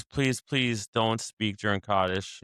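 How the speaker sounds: normal talking voice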